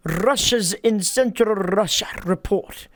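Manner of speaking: "Russia" trilled hard on the R; "Russia" with a rattling, exaggerated R; rolled R's. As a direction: roll every R in "Russia's" and "Russia". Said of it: "hardcore rolling those R's on Russia".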